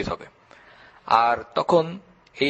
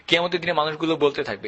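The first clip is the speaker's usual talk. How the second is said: ordinary talk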